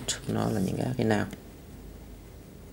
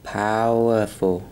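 slow, stressed pow; 4 dB louder, much slower than usual. Shown as a demonstration. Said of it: "Từ gốc của nó là power".

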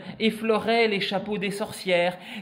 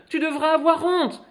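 scolding; 10 semitones higher, 4 dB louder than usual. Acted. Mother scolds Marine.

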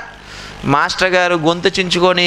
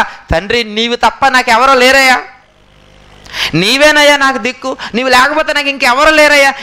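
loud, higher, anguished pleading to God; desperate prayer; 5 semitones higher, 4 dB louder than usual. Shown as a demonstration. Preacher models heartfelt begging to God, loud and anguished. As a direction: cry out to the Father as if all have betrayed you. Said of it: "దేవుని బతిమలాడడంలో కూడా మనం ఎలా బతిమలాడాలి?".

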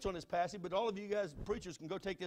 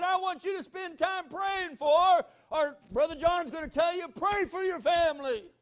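loud, higher, slowing sermon shout; preaching at full force; 11 semitones higher, 14 dB louder, slower than usual. Acted. Preacher urging his congregation to pray, full-force loud preaching. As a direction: hammer it home to the congregation from the pulpit.

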